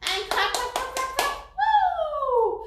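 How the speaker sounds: high, loud excited cheer. Real, not acted. Teacher cheering the class through a clapping warm-up.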